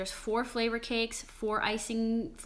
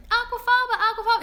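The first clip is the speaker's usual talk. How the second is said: high, loud gushing mock praise; mocking gushing fans; 12 semitones higher, 8 dB louder, faster than usual. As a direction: squeal the word like an overexcited fan. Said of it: "Everyone's like".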